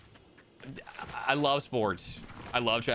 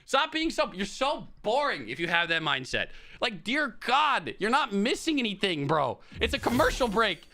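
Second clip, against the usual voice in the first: exasperated retort; 9 semitones higher than usual. Retold or imitated. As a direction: snap at the whiny fans to shut up. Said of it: "And it's like".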